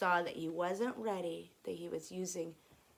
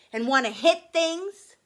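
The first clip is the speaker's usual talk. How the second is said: high, loud creature boast; forceful anger creature; 7 semitones higher, 13 dB louder than usual. Acted. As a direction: push it out with relish.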